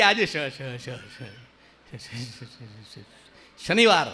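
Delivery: stuck on શ-, fading to nothing, then bursting out શનિવાર; schoolboy stammers the weekday; mock stammer. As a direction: stammer through શ- until the word pops.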